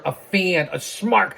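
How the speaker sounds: ordinary delivery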